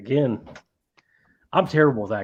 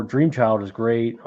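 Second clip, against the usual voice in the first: usual speaking voice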